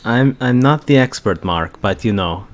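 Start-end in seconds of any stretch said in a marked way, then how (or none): none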